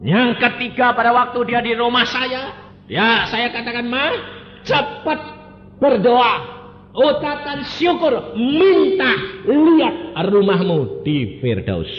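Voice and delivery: loud, high, fast sermon cry; fiery preaching. Acted. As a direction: preach it out at full force. Preacher urges mother to pray. (khotbah berapi-api dengan suara keras)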